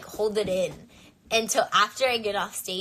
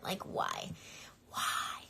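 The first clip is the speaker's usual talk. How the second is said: despairing whisper; 8 dB quieter, much slower than usual. Retold or imitated. Panic attack, inner groan.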